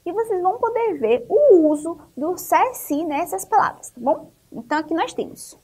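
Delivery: ordinary talk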